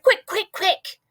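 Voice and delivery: high, urgent giant's voice; hurry, hurry, get it in; hurried urging. A narrator's reading. Giant rushing to jar the dream, eager and high.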